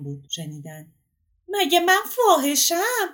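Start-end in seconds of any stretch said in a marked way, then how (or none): 1.5-3.1 high, drawn-out indignant shout; deliberately loud. A narrator's reading. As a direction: shout it out so the whole house hears. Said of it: "انگار به عمد بلند گفته بود". (فریاد بلند و کشیدهٔ عمدی از سر اعتراض)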